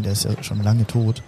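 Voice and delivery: normal talking voice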